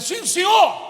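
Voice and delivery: shouted soldier's reply; barked order. Acted. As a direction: bark it like a soldier.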